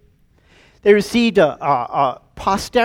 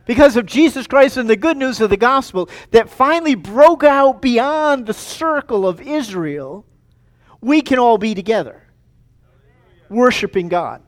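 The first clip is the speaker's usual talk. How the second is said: raised, forceful pulpit voice; emphatic preaching; 4 semitones higher, 5 dB louder than usual. Real, not acted. Preacher celebrating a room of many nations, emphatic proclamation.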